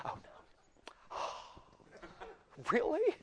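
mock-astonished woman with a gasp; hammed-up shocked gasp, then a high "really"; women gossiping. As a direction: imitate women reacting in hushed disbelief. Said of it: "if there's two women there talking".

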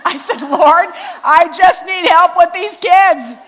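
high, very loud mock-desperate plea; exasperated shout played for laughs; comic exasperated outburst. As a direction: cry out for help over the laughter, playing up the desperation. Comic plea over audience laughter.

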